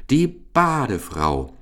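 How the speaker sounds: high, drawn-out announcement; delighted call. A narrator's reading. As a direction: call her out slowly with pleased fanfare. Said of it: "rief der Medizinalrat erfreut".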